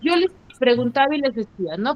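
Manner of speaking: normal talking voice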